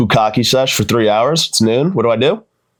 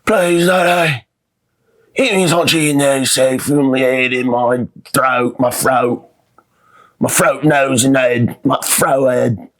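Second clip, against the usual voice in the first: higher, slow British orphan whimper; Oliver Twist; 3 semitones higher, slower than usual. Retold or imitated. Delirious son as Oliver Twist begging Daddy, British. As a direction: plead like Oliver Twist for more. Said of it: "I envisioned myself that I was Oliver Twist".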